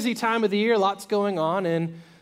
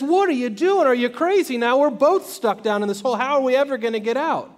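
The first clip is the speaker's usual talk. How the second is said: higher, louder acted protest; exasperated disbelief; 6 semitones higher, 5 dB louder than usual. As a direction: challenge a friend's foolish move in alarm. Trapped man scolds his friend.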